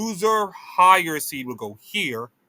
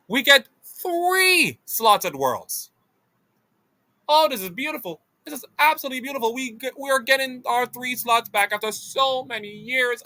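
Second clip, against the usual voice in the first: overjoyed; 9 semitones higher than usual. Real, not acted. Overjoyed about three Worlds slots.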